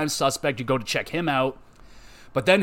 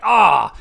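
frustrated yell; 5 semitones higher, 9 dB louder than usual. Retold or imitated. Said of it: "I was like".

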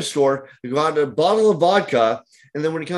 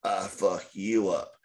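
menacing low threat; 7 semitones lower, 8 dB quieter, slower than usual. Retold or imitated. Drunk customer threatens the tattooist.

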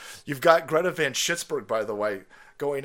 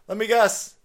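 normal talking voice

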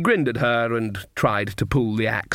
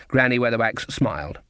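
ordinary delivery

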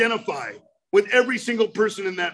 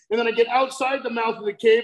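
ordinary talk

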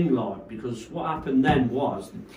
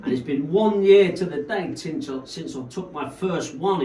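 raised, louder mock stage announcement; 6 semitones higher, 3 dB louder than usual. DJ addresses the crowd at his first set, imitated, pitched up. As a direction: imitate a New York DJ on the mic. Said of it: "when he first came on to his first set".

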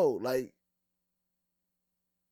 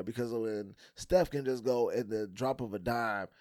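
ordinary talk, nothing marked

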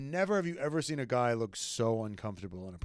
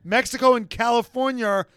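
high, loud mock scolding; exasperated retort; 9 semitones higher, 7 dB louder than usual. Host retorting to Checo with high, loud exasperation. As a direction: scold him like an idiot. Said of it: "It's like".

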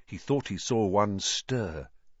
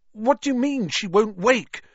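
high, loud desperate question; alarmed high cry; 12 semitones higher, 7 dB louder than usual. Acted. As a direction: demand an answer in panic. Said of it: "Harry said desperately".

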